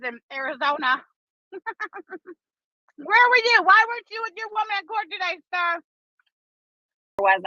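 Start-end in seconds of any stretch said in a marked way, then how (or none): 1.5-2.3 giggle. Real, not acted. Laughing at own joke. 3.0-5.8 mock scolding. Mock scolding absent boyfriend.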